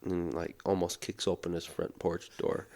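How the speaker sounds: ordinary talk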